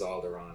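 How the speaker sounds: "Alderaan" ending in a long "ahn"; Obi-Wan's pronunciation. Retold or imitated. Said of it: "Obi-Wan definitely says".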